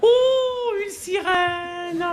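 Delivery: high, drawn-out gasp of wonder; amazed wonder. Acted. Narrator marvelling at a mermaid underwater. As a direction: marvel at it slowly.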